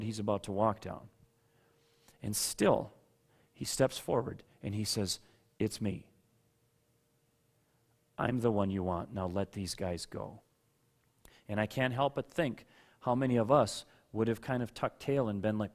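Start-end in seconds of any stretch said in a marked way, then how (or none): 5.6-10.4 soft quiet Jesus voice. Acted. Jesus voiced softly in retelling.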